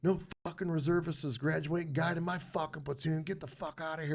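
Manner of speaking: a hint of the furious sergeant throwing him out; mock drill instructor.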